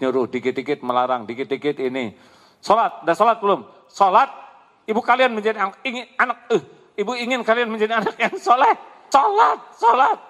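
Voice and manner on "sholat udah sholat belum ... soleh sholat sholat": put-on high, loud, fast nagging mother yelling at the kids; shrill-free mock scold, loud and high; nagging shout. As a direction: bark at the kids to pray like a nagging mom. Mother nagging child to pray.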